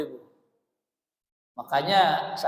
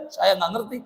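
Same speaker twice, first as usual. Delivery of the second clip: ordinary talk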